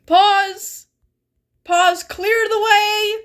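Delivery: high, loud calls to stop; frantic shouting. Real, not acted. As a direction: bark out urgent commands.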